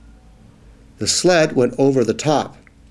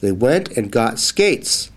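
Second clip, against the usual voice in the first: plain reading voice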